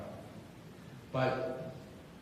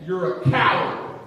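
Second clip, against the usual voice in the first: loud, slow, raised-pitch taunt; angry accusation; 7 semitones higher, 14 dB louder, slower than usual. Acted. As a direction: hurl the insult, stretching each word. Acted cyclist taunting the driver.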